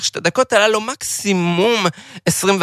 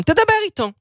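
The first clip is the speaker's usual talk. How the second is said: wife's voice; 11 semitones higher than usual. Retold or imitated. Wife's voice in story.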